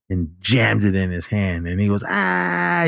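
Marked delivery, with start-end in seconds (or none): 2.1-2.9 high, held mock scream at normal volume; imitated pain scream. Recapping the killer's stabbed hand, mock scream. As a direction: mimic a man yelling in pain. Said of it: "he was laying there screaming".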